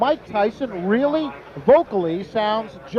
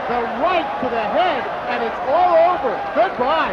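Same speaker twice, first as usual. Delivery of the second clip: play-by-play; 3 dB louder than usual. Real, not acted. Live call of the finish.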